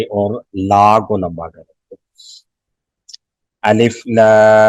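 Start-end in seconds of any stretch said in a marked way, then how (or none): 3.6-4.7 slow, drawn-out chant of the letters; Quranic recitation. Shown as a demonstration. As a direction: recite it with long madd. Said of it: "सौ को लंबा करेंगे". (क़ुरआनी तिलावत, खींचकर)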